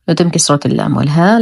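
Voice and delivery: ordinary talk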